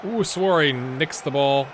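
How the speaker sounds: normal talking voice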